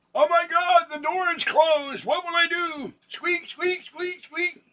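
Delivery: very high, loud squeaky cat in a panic; panicked cat voice. Pretend. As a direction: cry it out like a frantic little kitty.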